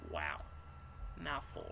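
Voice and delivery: ordinary delivery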